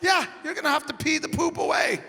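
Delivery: high-pitched mock insistence; imitating an insistent, high-voiced girlfriend.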